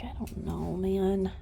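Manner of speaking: soft whispered horse voice; unsure mare, whispered; half-whispered voicing of a doubtful horse. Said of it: "She's like".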